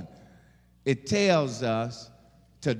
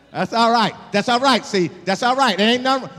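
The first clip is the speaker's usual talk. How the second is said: louder and higher, half laughing; loud preaching; 6 semitones higher, 7 dB louder than usual. Acted. Preacher shouting reassurance to the congregation, loud. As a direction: shout it out with joy.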